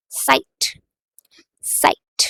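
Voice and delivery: साइट said with an S for Z, zait as sait; साइट, clear S at the start; German pronunciation. Shown as a demonstration. Teacher models साइट for the German Zeit. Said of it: "German में बोला जाता है".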